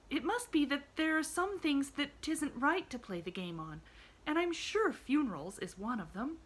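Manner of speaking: put-on a bright little girl, gently arguing; a hint of an earnest young girl; Pollyanna. A narrator's reading. Pollyanna voiced in story.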